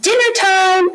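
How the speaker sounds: understated storybook yell; mild mock scream. A narrator's reading. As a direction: call it out high, without a real scream. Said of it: "When Martin screamed".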